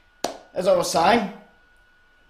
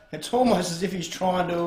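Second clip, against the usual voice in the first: normal talking voice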